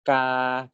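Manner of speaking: ma and ka said on a level mid tone, steady pitch; mid tone demo. Shown as a demonstration. Teacher models mid tone syllables for students, level and steady. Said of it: "default tone is the first one of the spectrum".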